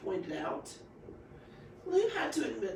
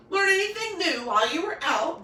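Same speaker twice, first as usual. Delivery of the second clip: father's question; 8 semitones higher, 9 dB louder than usual. Acted. Daddy asks his daughter.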